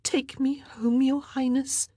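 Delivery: high, whispered begging; girl begging the queen in a hushed plea. A narrator's reading. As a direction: plead in a whisper. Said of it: "she begged".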